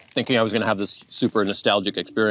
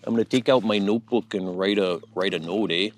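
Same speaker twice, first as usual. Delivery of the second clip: hoser Canadian accent. Shown as a demonstration. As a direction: put on a Rick Moranis-style Canadian accent. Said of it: "stereotypical kind of hoser Canadian accent".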